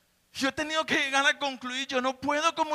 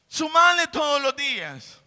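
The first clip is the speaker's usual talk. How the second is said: building, louder exhortation; fiery preaching; 3 semitones higher, 4 dB louder than usual. Acted. Preacher urging congregation to add virtue, louder and building. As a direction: drive the exhortation home, rising.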